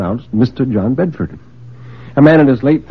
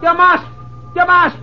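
very high, frantic cry; panicked outburst. Acted. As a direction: burst out in desperate panic. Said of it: "he burst out".

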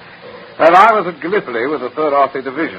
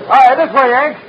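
loud, high hail across a station platform; calling out; 12 semitones higher, 5 dB louder than usual. Acted. Lieutenant hails the American officer outside the train, calling out loud. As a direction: call out to him, waving him over.

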